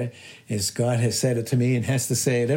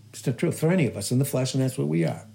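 normal talking voice

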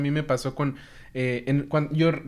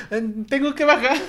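embarrassed shout; 9 semitones higher, 4 dB louder than usual. Retold or imitated. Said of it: "entonces le grité".

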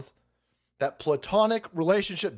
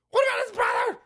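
shouting; faster than usual. Acted. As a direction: yell the question up high. Shouted mock question.

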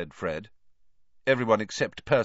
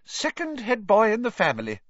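proud, raised voice; beaming with pride over her son; swelling with pride; 14 semitones higher, 5 dB louder than usual. A narrator's reading. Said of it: "swelling with pride".

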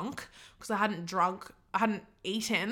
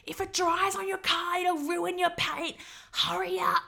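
mock angry car wash guy; 9 semitones higher than usual. Car wash guy yelling at her.

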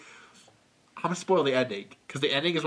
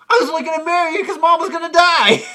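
high, loud squeal through laughter; mock shock cracking up; laughing outrage; 12 semitones higher, 9 dB louder than usual. Acted breakup, laughing.

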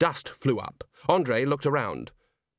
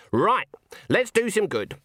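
higher-pitched rallying announcement; brisk resolve; 9 semitones higher than usual. Acted. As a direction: declare it as a call to action. Granny rallies herself aloud.

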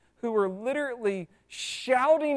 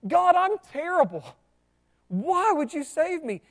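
anguished cry to God; 6 semitones higher, 7 dB louder than usual. Retold or imitated. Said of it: "you go".

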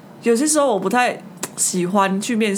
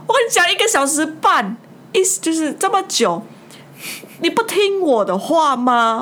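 mock-irritated old man, higher pitch; a bit annoyed; 7 semitones higher than usual. Old boss scolding the interviewee for leaving, higher mock irritation. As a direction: lightly imitate his peeved scolding, higher. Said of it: "有点生气".

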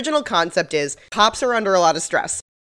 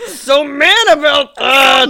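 slow drawn-out teasing, building louder and higher; hammed-up dread; 6 semitones higher, 7 dB louder, slower than usual. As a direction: stretch every word in mock alarm. Both hosts hamming up captain's coming anger.

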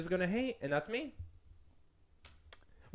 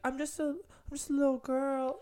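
put-on little girl voice; 10 semitones higher than usual. Mocking a TikTok audio in a little-girl voice. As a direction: coo it like a tiny girl. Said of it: "another audio that was like".

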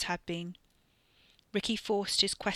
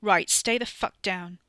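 loud, slow, threatening order; hard, slowed warning to a fallen cousin; stern command; 6 dB louder, slower than usual. Acted. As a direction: bark it down at him. Ricky orders beaten cousin down.

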